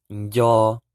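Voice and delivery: "yo" loud and clear, prenasalized; teacher drilling nc syllable; Hmong nco. Shown as a demonstration. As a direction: announce "yo" clearly. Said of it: "N C is pronounced".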